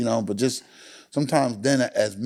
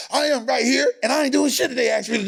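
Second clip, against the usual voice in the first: much higher cartoonish dad voice; mock defiant dad; 11 semitones higher, faster than usual. Guest imitating his stubborn dad for the host, high and mocking. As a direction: lampoon the stubborn father.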